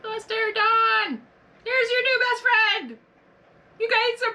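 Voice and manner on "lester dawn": ordinary talk, nothing marked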